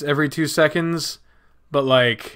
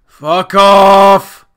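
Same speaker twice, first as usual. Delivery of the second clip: loud, raised, drawn-out curse; angry shout; 4 semitones higher, 9 dB louder than usual. Real, not acted. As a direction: yell it with mounting irritation. Repeats curse louder.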